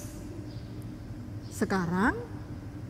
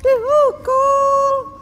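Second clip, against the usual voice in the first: put-on high, loud childlike voice, stretching words; a little girl telling her friends what she learned; cheerful child addressing friends; 11 dB louder than usual.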